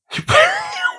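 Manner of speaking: a high shrieking burst of laughter. Real, not acted. Remembering the film's funniest bit, shrieking laugh. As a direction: crack up in a high squeal. Cracking up at scene.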